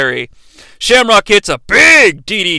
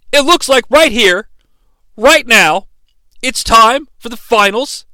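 usual speaking voice

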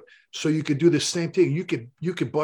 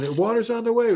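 pitched up, mock yell over the radio; raised retold shout; 9 semitones higher, 3 dB louder than usual. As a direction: call it out like a frantic radio order. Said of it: "he's yelling".

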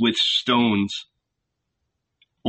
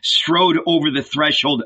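ordinary delivery

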